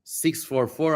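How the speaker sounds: ordinary talk, nothing marked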